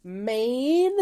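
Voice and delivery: laughing at his freaked-out passenger. Retold or imitated. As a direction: tell him to relax while cracking up. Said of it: "I'm like".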